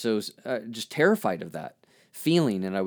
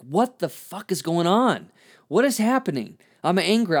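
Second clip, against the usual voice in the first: higher, exasperated inner outburst; frustrated outburst; 6 semitones higher, 3 dB louder than usual. Retold or imitated. Reliving a clogged moment, a frustrated outburst. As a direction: vent the confusion, half-shouting to yourself. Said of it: "I started to get so frustrated".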